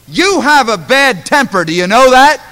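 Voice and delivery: shouted high and loud, jabbing; loud accusation. Retold or imitated. Man confronting the pastor, loud accusing shout. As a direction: yell the accusation at him.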